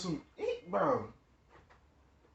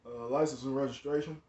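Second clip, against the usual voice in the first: a hint of a stern officer, lower and quieter; lightly voiced cop asking for papers; 5 semitones lower, 4 dB quieter than usual. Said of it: "That's really how he sound".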